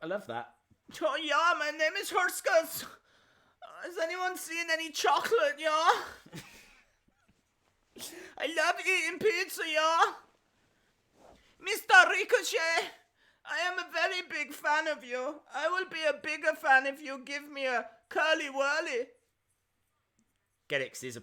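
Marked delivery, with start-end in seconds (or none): 1.0-5.9 put-on high, loud pig-boy voice with a thick German accent; big cartoon German accent, high and shouty. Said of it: "he's got a German accent". 8.4-10.1 put-on high, loud pig-boy voice with a thick German accent; big cartoon German accent, high and shouty. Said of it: "he's got a German accent". 11.6-19.1 put-on high, loud pig-boy voice with a thick German accent; big cartoon German accent, high and shouty. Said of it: "That was an impression of [name]".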